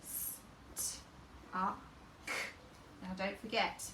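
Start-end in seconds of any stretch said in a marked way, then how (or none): 0.0-2.3 St, ah, k whispered apart; sounding out st, ah, k; segmented phonemes. Shown as a demonstration. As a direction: split the word into st, ah, k softly.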